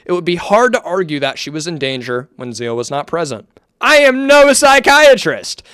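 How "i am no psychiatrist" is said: higher, louder and slower, punching the point; emphatic disclaimer. Real, not acted. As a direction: announce it loudly, with emphasis.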